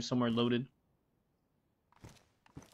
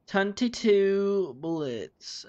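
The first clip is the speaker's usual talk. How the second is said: higher, louder and very slow; drawn-out sing-song; 5 semitones higher, 5 dB louder, much slower than usual. Shown as a demonstration. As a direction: stretch each word out playfully. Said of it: "repeated drawn out".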